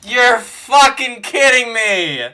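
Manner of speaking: loud, slow, outraged disbelief; outraged shout. Real, not acted. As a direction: yell in frustrated disbelief. Losing the final pull.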